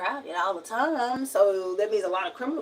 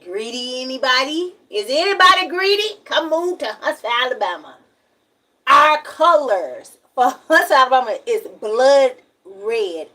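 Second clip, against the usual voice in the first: sarcastic, higher, louder sales call; mock advert pitch; 4 semitones higher, 8 dB louder than usual. Bitter local mocking Huntsville as a tourist pitch, sarcastic ad voice. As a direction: hawk the town like a bitter ad.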